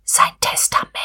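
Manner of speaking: secretive murmur into an ear; whisper. A narrator's reading. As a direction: whisper it confidentially. (geheimnisvoll geraunt, geflüstert)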